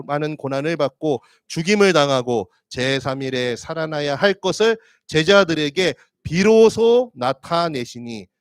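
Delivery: slow, loud, measured Bible reading; scripture reading. Acted. Pastor reading Matthew to the congregation, slow and loud. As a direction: read it slowly and firmly, as from the pulpit.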